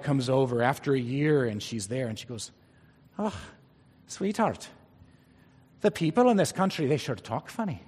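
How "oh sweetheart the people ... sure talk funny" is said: a light, drifting Irish lilt on the wife's quote; wife's line slipping into an Irish accent; accidental Irish accent. Retold or imitated. Preacher telling a joke, voices the wife in a mild Irish accent. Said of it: "Suddenly, the Scotsman's Irish".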